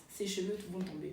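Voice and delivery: a quiet aside to the side; low voice. Retold or imitated. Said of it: "d'une voix toute basse".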